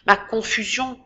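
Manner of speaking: usual speaking voice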